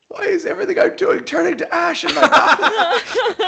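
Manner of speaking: high, loud laughing complaint; laughing lament. Real, not acted. Player laughing at his own bad luck, high laughing wail. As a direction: moan it through laughter.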